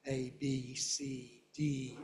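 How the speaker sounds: "A, B, C, D" each with a drawn-out vowel; long vowels. Shown as a demonstration. Teacher showing letter names, long stressed vowels. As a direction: give every letter a full long vowel. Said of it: "they end in long vowels".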